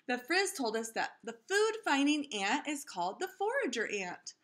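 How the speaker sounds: lively storybook read-aloud voice; reading to children. A narrator's reading. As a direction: read it like story time for a class. Reads picture book aloud to kids.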